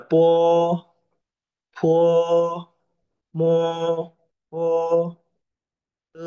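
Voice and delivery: "Po, po, mo, po, te" high, level and held; first tone. Shown as a demonstration. Teacher shows how to read it, level tone one. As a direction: sustain each "Po" and "mo" without moving the pitch. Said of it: "Bacanya datar".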